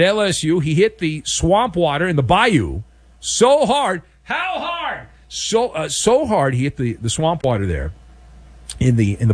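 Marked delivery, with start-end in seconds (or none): 4.2-5.1 high, hushed crowd call-back; high and soft, playing the crowd; audience call-back. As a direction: chime in like a distant audience. Voices audience call-back to own joke.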